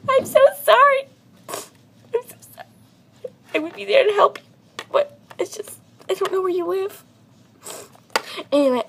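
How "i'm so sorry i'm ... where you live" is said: high-pitched whimpering apology, half whispered; mock crying apology. Imitating a friend's teary reply to the dentist post, high and whimpery. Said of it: "sounded like a little crying face".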